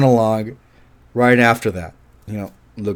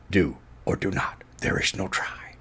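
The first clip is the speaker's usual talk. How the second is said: hushed, softer Yoda line; Yoda quote; 5 semitones lower, 13 dB quieter than usual. Retold or imitated. Fan quietly quoting Yoda to listener, hushed voice. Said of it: "the best Yoda line".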